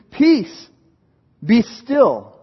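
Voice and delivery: high, loud command to the storm; commanding rebuke. Acted. Jesus rebuking the wind and sea, loud command. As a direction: order the sea to calm down.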